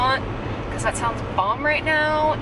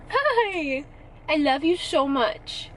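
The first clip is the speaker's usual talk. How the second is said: higher, gentle cooing to the cat; soothing pet talk; 4 semitones higher than usual. Real, not acted. Owner soothing nervous Penny in the car, gentle coo. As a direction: reassure a scared kitty.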